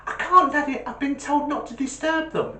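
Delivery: put-on high, childlike little boy. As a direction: play a small child telling his dad. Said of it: "this little voice goes".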